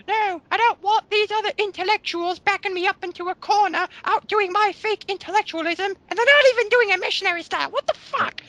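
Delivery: mock her in a silly falsetto; mocking the commenter in a ridiculous high voice. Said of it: "it's like".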